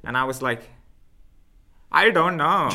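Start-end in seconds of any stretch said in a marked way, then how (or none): 1.9-2.7 higher, louder put-on Indian accent; mocking Indian accent. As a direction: mimic a customer back in a mock Indian accent. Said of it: "With the accents, man".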